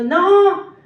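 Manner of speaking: loud refusal from the crowd; crowd shouting. A narrator's reading. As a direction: call it out like a rejecting mob. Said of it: "dieron voces de nuevo diciendo".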